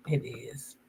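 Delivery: breathy whispered grief. Real, not acted. Remembering the dog they lost, whispered sadly. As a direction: let it fall to a whisper.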